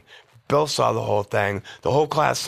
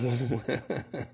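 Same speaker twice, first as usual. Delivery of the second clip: soft amused chuckle; 5 dB quieter than usual. Real, not acted. Man dismissing school, soft chuckle. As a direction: laugh off your own misspent youth. Laughs about quitting school.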